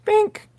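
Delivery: high squeaky bink; camera click noise. Real, not acted. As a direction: chirp a camera sound. Camera shutter noise imitation.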